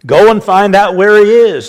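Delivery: loud, high, commanding order; a king shouting for his men; king's order. Acted. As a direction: bark it out like a furious king. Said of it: "the king ordered".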